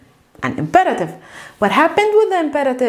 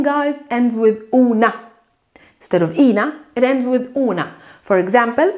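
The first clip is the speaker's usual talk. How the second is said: usual speaking voice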